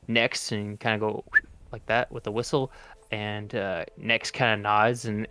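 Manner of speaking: normal talking voice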